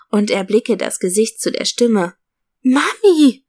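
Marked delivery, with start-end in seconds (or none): 2.6-3.4 high, loud, overjoyed cry of Mami!; child calling out to her mother in delight; excited shout. A narrator's reading. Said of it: "rufe ich ganz aufgeregt". (aufgeregter, lauter Freudenruf)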